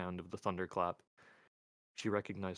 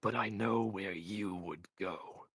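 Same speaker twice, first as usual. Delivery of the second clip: slow, deliberate threat; measured, ominous delivery; slower than usual. A narrator's reading. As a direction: draw each word out with intent. Mort softly threatens Albert.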